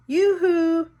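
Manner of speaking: high, loud yoo-hoo through the house; mock call to an empty house, high and loud; sing-song call. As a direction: lilt it out like calling upstairs. Pretending to call her mother.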